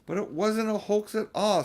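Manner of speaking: put-on young Lucy. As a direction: plead like an earnest little girl. Lucy protesting.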